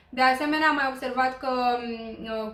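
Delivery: ordinary delivery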